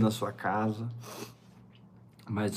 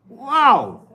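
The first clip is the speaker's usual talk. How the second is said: surprised exclamation; 13 semitones higher, 10 dB louder than usual. Acted. Exclaims at Paul's words.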